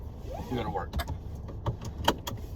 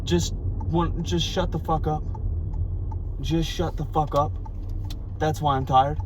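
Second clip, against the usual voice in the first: softer, higher worn-out venting; quiet exasperation; 4 semitones higher, 6 dB quieter than usual. Real, not acted. Fed-up man venting about his brother, softer. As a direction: mutter it, drained and fed up.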